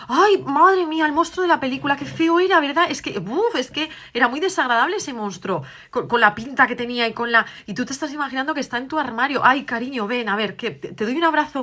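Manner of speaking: tender comforting mother. Acted. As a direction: wrap her in comfort as you speak. Madre consuela y abraza a la hija. (la madre abraza y consuela a su hija, voz tierna y aguda)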